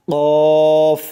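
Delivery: Arabic qaf said thick; firm and thick. Shown as a demonstration. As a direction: hit কফ heavy and firm. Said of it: "মোটা করে".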